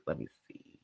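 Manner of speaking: whispered, fading almost to nothing. Real, not acted. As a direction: let the words trail off into a breath.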